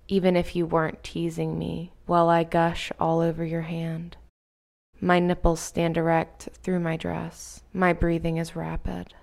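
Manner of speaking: plain reading voice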